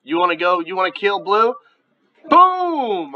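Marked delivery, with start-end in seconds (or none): 2.3-3.1 high, drawn-out explosion call; triumphant boom. Real, not acted. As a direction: punch it high as the hit lands. Celebrates a hit in game.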